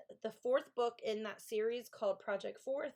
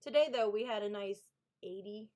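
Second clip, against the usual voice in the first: ordinary talk, nothing marked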